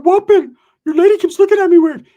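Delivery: high loud yell at him; [name] yelling at the host; mock angry shout.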